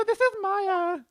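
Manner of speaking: put-on very high falsetto valley girl; Maya voice. Maya answering her sister's call, high valley girl. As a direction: squeak it out like a bubbly teen girl. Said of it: "not the Maya voice".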